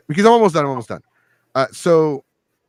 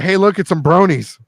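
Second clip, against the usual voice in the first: teasing call out; 6 semitones higher, 4 dB louder than usual. Retold or imitated. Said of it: "I'm like".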